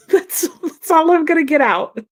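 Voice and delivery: laughing at her own blank mind; giggling, lost for words. Real, not acted. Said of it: "I know, I know".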